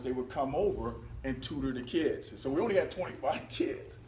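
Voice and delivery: ordinary talk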